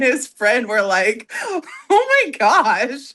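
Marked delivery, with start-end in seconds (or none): 1.4-3.1 drawn-out, high mock-scandalized gasp of words; retold shock. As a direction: imitate two stunned friends, slow and high. Retold shocked reaction to her joke.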